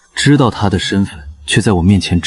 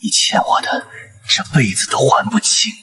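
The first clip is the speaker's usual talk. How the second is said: menacing whisper; 6 semitones higher, 3 dB quieter than usual. Acted. General whispers menacingly to A-Wang. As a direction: breathe it as a threat.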